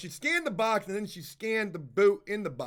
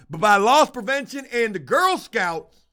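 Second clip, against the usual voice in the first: louder, slower, punching each word; retold rant; 2 semitones higher, 7 dB louder than usual. Telling viewers how he yelled at the cashier, loud. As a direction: slam the ending home in mock outrage.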